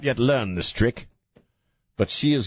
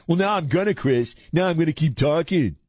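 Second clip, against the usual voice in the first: imitating co-host; 6 semitones higher than usual. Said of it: "see how you are?".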